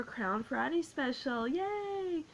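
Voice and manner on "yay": excited cheer. Real, not acted. Opening cheer for the special.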